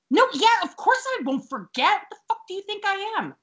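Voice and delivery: high-pitched mock indignation; mad at the phone. Tootie telling Gil she won't forget, mock-indignant. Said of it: "she's mad at the phone".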